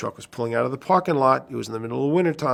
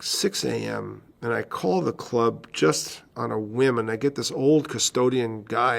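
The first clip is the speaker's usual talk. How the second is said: ordinary delivery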